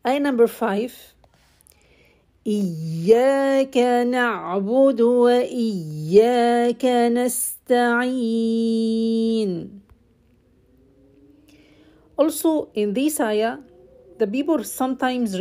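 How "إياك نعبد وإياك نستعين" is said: slow chanted recitation; Quran recitation. Acted. Teacher reciting the fifth ayah. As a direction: recite the verse slowly and melodically.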